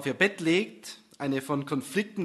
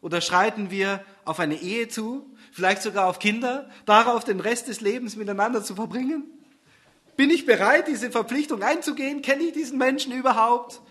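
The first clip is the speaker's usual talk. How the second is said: lightly higher anxious inner voice; fretting about the relationship, climbing higher; worried girlfriend's thoughts; 6 semitones higher, 5 dB louder than usual. Acted. Susanne worrying about marriage, higher anxious voice.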